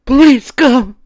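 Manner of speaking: high, loud desperate plea; terrified begging. A narrator's reading. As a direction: beg through terror. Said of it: "she begged again".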